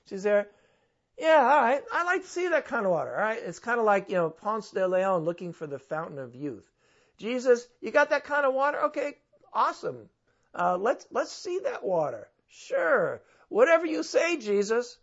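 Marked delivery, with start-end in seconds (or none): 1.2-3.4 high, fast and mocking; sarcastic. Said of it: "she's actually being kinda, like, sarcastic". 7.2-15.0 high, loud, mocking tone; sarcastic. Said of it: "she's actually being kinda, like, sarcastic".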